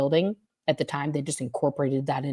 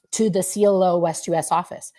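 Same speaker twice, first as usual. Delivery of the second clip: usual speaking voice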